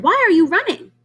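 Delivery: high goose voice, loud; worried goose calling out. Acted. Goose voice asks question.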